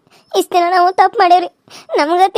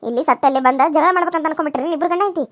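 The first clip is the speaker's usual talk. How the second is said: normal talking voice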